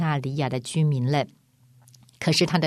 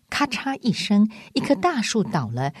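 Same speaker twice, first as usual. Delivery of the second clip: ordinary delivery, nothing marked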